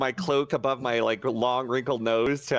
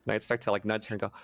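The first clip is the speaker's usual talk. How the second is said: ordinary talk, nothing marked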